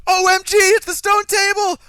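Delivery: hammed-up breathless excitement; mock overexcited gushing. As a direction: parody characters who spell everything out. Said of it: "the characters would have been going".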